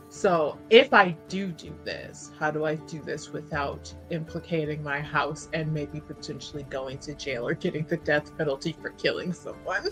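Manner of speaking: usual speaking voice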